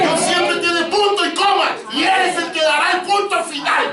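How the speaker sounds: high, slowed, ringing pulpit delivery; emphatic preaching. Real, not acted. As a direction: drive each point home to the congregation.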